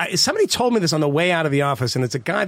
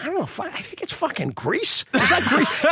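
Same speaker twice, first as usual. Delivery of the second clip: higher, puzzled mumbling guess; confused quiz player; 8 semitones higher than usual. Retold or imitated. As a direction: play a lost pub quiz guy.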